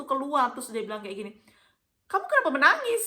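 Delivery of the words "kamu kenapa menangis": very high, loud mock exclamation; astonished question. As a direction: imitate a startled husband asking loudly. Husband surprised she is crying. (tiruan suami kaget, suara melengking)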